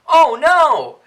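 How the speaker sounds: loud gasping oh no; hammed-up shock; mock alarm.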